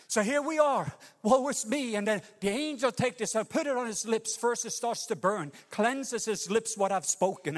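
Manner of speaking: high, emphatic sermon voice; fervent preaching. Acted. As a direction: lift the room with it. Preaching at full force.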